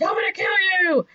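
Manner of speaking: high, fast mock rage; super mad. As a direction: rush it out like an enraged villain. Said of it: "he gets super mad at Rick".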